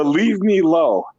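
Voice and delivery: slow "Leave me low" with "alone" shortened to "low"; broken English "low". Retold or imitated. Imitating his father's saying, stretches out "low". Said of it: "he go".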